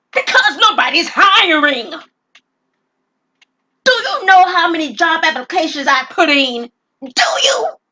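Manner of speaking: loud, high desperate yell; frustrated shout. Acted. Robber yelling at the clerk about job hunting, loud and high. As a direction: throw the question back at her.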